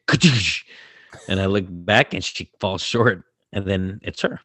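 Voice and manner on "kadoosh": loud "kadoosh" crash noise; imitated crash. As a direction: imitate a sudden crashing impact. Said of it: "and I just hear".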